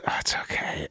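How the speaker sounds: breathless soft whisper; soft whispered comfort; whispered reassurance. Retold or imitated. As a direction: hush it like a secret. Retold woman reassuring him.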